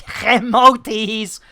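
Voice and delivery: "emojis" with a hard throaty H in front; added H sound. Pretend. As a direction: put a jokey H before "emojis". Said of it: "I've gotta stop doing that".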